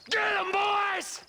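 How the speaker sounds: slow drawn-out shout to his gang; shouted order. Acted. Outlaw leader shouting at his gang to attack.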